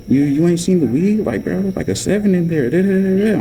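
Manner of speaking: high mock badgering, trailing into da-da-da; nagging questioner. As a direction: imitate her pestering in a high voice. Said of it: "She asked me again".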